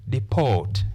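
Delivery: "deport" with a long, stressed PORT; "deport" as di-PORT, falling; stress on second syllable. Shown as a demonstration. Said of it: "We stress the second syllable".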